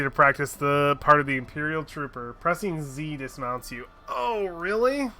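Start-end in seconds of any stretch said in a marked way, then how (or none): none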